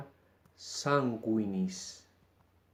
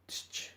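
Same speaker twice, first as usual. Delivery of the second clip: a soft ts-ch sound with no vowel; demo consonant sound; 12 dB quieter than usual. Shown as a demonstration. Teacher isolating the sound of C before E, soft. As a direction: breathe out tsch softly. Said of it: "su sonido será tsch".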